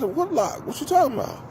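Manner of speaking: high, mock-innocent playing dumb; feigned innocence. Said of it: "I said".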